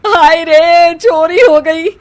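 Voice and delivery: loud, high, furious lament; mother-in-law shrieking about stolen money; angry wailing shout. Acted. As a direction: wail at the whole house in rage. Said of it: "गुस्से में चिल्लाते हुए".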